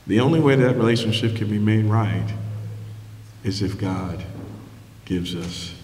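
fading hush. Acted. Preacher closing the point to the congregation, hushed and low. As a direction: let it sink to a hush. Sermon climax drops to hush.

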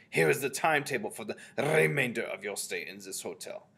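loud witch leader with rolled Rs; imperious Grand High Witch. A narrator's reading. Grand High Witch giving the timetable to witches, imperious voice.

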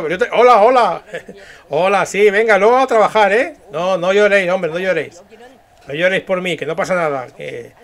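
jovial leader calling to the crowd; dubbed leader. Acted. Leader telling followers not to cry for him, jovial public address. Voy a hacer otra vez el doblaje.

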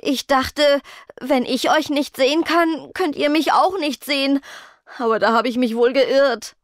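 high, plaintive whine; whining. A narrator's reading. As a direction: lament it like a sorry little creature. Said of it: "jammerte Hudud".

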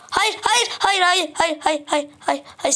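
high, rapid no's fading down; panicked pleading. Real, not acted. Gamer begging the enderman to stop, panicked. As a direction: beg it off in a panic then sag.

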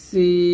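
"সিন" with a whistling s and very long ee; thin, drawn-out letter. Shown as a demonstration. Teacher demonstrates for the class, "সিন" thin and stretched long. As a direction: hiss the s thinly, then hold "সিন" for four counts. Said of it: "পাতলা করিয়া চার আলিফ টানিয়ে".

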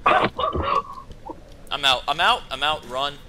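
panicked bailing out. Real, not acted. Fleeing the opened door.

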